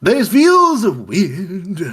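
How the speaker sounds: slow, high, loud crooning; a jukebox singing out its words. Acted. GM voices the jukebox.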